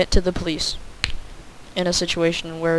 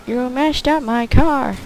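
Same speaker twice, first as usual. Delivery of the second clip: slow, slightly higher put-on complaint; mock lady's voice; 6 semitones higher, slower than usual. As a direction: drag out the complaint like a fussy lady. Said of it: "that's, like, her voice".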